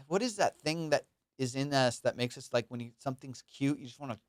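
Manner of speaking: ordinary delivery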